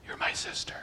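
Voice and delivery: whispered secret plan; husband whispering the plan to his wife. Acted. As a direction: whisper it to her conspiratorially. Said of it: "he leans over and says".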